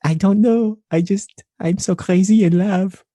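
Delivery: high, loud mock French lovesick; hammed-up French accent. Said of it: "That's a good accent right there".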